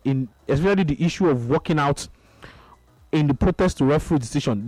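usual speaking voice

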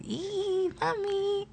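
high, soft whimpering mommy; mock child calling mommy. Host imitating a child crying for her mother. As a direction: whimper like a baby wanting mom.

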